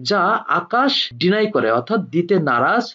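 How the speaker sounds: normal talking voice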